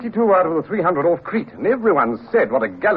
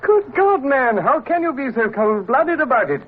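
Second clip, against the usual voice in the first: shocked outburst; 10 semitones higher than usual. Acted. Captain outraged at cold account.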